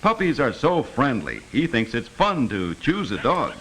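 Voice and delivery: normal talking voice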